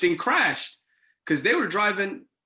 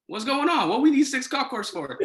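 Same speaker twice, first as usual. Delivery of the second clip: startled, asking what on earth is happening; alarmed; 9 semitones higher than usual. Retold or imitated. RA seeing six cop cars arrive, alarmed and high-pitched.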